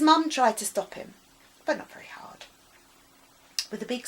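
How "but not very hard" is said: hushed and wry; whispered aside. A narrator's reading. Narrator whispering about the mum. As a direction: drop to a knowing whisper.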